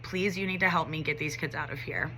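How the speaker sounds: hushed, understated retold pleading; near-tearful plea. Teacher pleading with administrator, retold quiet near-tearful plea. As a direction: beg softly, close to tears. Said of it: "I looked at her with, like, nearly tears in my eyes".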